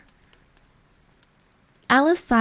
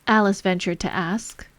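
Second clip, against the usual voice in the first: ordinary delivery, nothing marked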